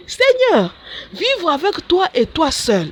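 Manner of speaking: pleading cry. Retold or imitated. Nun begging Jesus to let her be, high plaintive plea. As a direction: plead with the Lord.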